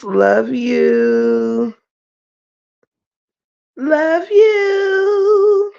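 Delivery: slow, high, crying-tinged love you; tearful love you. Real, not acted. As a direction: draw it out, moved to tears. Greeting viewers in chat.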